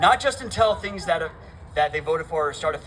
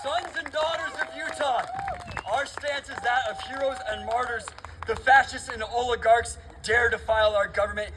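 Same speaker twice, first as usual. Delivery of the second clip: roaring rallying speech; 3 semitones higher than usual. Real, not acted.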